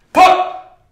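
loud, high imitated gun pop; loud mouth-made gun sound; mock gunshot pop. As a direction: spit out a gunshot noise. Imagined gunshots.

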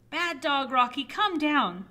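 loud storybook scolding; mock scolding. Owner ordering a climbing pet down, loud mock scolding. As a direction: scold the pet loudly, in play.

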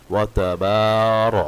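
তাবার with the বা drawn one alif; slow, loud তাবার; stretched ba. Shown as a demonstration. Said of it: "এখানে রো উচ্চারণটা হচ্ছে". (বাতে এক আলিফ টান)

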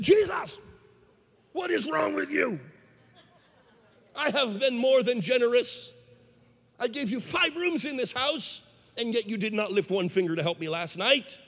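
high, indignant telling-off; angry. Acted. Man scolds Jesus for not helping him, angry. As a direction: act out an exasperated man demanding answers. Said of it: "he got angry".

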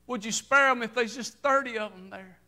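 high, loud preacher's cadence; rising fervent sermon delivery; full-force preaching. Acted. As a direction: preach it out to the congregation.

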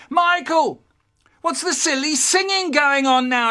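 loud, high telling-off from downstairs; mum calling upstairs. Acted. Mum to noisy son from downstairs, scolding call. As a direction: shout up the stairs, exasperated and high-pitched. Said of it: "I hear my mum from downstairs going".

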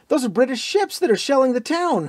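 high, loud thrilled shout; soaring excited cry; excited yell. A narrator's reading. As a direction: yell it with wild hope. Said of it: "Fallon yelled excitedly".

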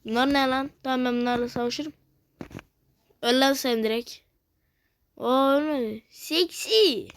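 ordinary talk, nothing marked

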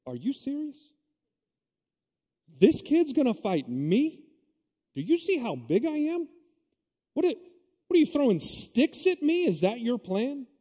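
put-on high, scornful giant taunting a kid; indignant mocking. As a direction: sneer at the puny boy in disbelief. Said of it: "it's Goliath's turn to be indignant".

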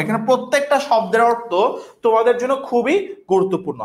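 ordinary talk

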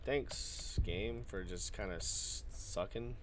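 soft, slow sarcastic grumble; sarcastic mutter. Real, not acted. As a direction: grumble it half to yourself. Complaining after dying.

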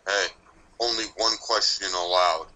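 plain reading voice

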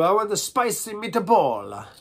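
loud, high, slow Italian accent. Shown as a demonstration. Accent warm-up, hammed-up Italian accent. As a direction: ham up a sing-song Italian accent. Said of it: "How about Italian?".

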